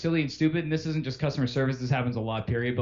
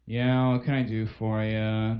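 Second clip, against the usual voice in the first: low, slow don't-care monotone; bored bad rep; 8 semitones lower, slower than usual. Bad rep answering a customer, couldn't care less. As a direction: drone it out with zero interest. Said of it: "a really bad rep who's just like".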